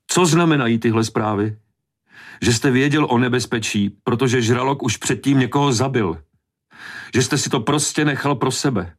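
high, sustained accusing voice; distraught woman pressing her charge; angry accusation. A narrator's reading. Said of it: "z čeho ho žena obviňovala".

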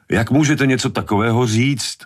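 high-pitched, offended protest; indignant outburst. A narrator's reading. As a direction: protest hotly, pitch leaping up. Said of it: "rozčílil se Hooper".

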